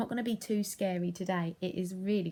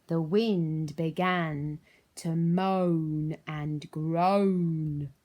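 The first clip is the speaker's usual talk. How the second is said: slow, louder moaning groan; moaning wind; 8 dB louder, much slower than usual. A narrator's reading. Teacher reading spooky story, moaning wind. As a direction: moan it like the wind.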